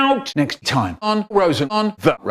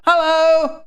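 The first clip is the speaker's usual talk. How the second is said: high call; 12 semitones higher, 4 dB louder than usual. Acted. Waking dazed, calling out.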